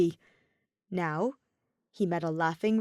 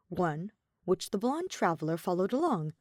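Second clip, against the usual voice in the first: plain reading voice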